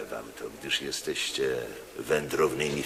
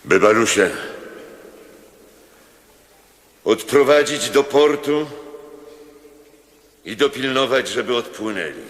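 commanding order; 8 semitones higher than usual. Acted. Proconsul commands a soldier.

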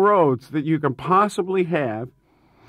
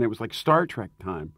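usual speaking voice

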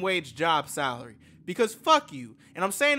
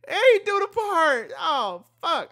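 loud, high-pitched disbelieving reaction; excited outburst; 10 semitones higher, 8 dB louder than usual. Real, not acted. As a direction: cry out over and over, carried away. Outburst reacting to the music video.

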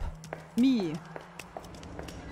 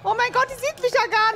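high, loud desperate cry; panicked wail; 10 semitones higher, 4 dB louder than usual. Real, not acted. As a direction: wail it out helplessly. Helpless while monster attacks.

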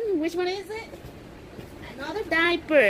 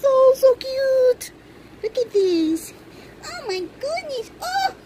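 overjoyed squeal; squealing delight; 9 semitones higher than usual. Real, not acted. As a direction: gush with real joy. Gushing over baby's pajamas.

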